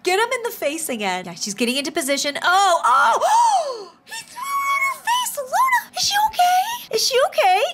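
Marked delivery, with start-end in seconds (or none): 2.4-7.6 very high, shrieking alarm; squealing, excited disbelief; shocked outcry. Real, not acted. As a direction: scream out at a shocking hit. Shocked at snowball in face.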